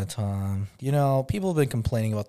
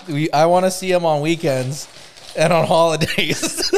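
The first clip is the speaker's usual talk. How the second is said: laughing; 3 semitones higher, 6 dB louder than usual. Real, not acted. Joking custody of seashells.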